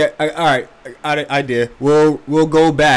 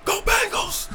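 cheering; 4 dB quieter, slower than usual. Real, not acted. Cheering for team.

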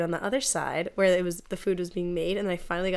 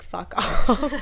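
laughing; 4 semitones higher, 6 dB louder than usual. Retold or imitated. Laughing retort in retold story.

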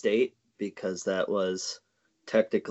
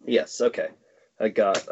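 normal talking voice

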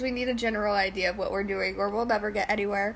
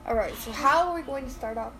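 ordinary delivery

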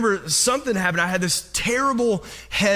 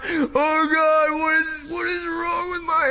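high, tearful panicked plea; panicked crying; 9 semitones higher than usual. Retold or imitated. Teen scared in the night, crying out to God in panic. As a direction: cry out in teenage panic, voice breaking. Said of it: "I just start crying".

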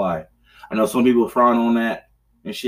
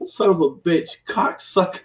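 higher-pitched cursing that drops to an airy rasp on the last word; retold cursing; 7 semitones higher than usual. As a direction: relive the rage at the game, half-voiced. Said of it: "And like".

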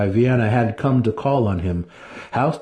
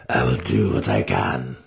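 lower, resigned agreement; subdued wizard; 8 semitones lower than usual. A narrator's reading. Wizard yielding to the priestess, low and resigned. Alton voiced.

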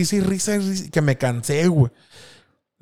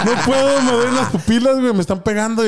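queja aguda y lloriqueada; quejido agudo; 9 semitones higher than usual. Retold or imitated. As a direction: se queja agudo como niño adolorido. Whining in pain, stoned. (quejido agudo de dolor)

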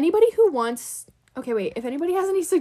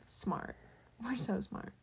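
quiet; 16 dB quieter, much slower than usual. Real, not acted. Mock self-praise, muttered.

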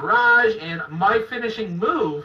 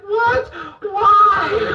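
much higher, louder startled cries; mock panic; 11 semitones higher, 5 dB louder than usual. A flower pot is about to hit him, mock panicked cry. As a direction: squeal in mock disbelief. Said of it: "I'm like".

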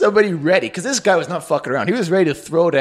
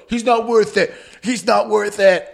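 pleading friends; 5 semitones higher than usual. Acted. Friends holding back a fighter.

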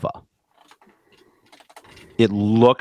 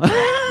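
laughing shriek. Real, not acted. Laughing outburst mid rant.

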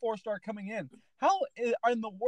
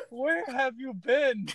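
normal talking voice

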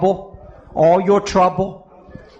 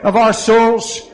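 loud, raised preacher's prayer; fervent prayer; 4 semitones higher, 4 dB louder than usual. Real, not acted. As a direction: call on God with force over the people. Prays over the congregation.

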